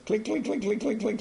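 high mock clinking, clink clink clink; clinking drumbeat. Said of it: "It's beating its drum and saying".

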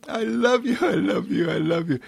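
very high, repeated love chant; gushing adoration. Acted. My thoughts speak to me, high gushing adoration. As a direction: coo it over and over in a high voice. Thoughts' loving words acted out.

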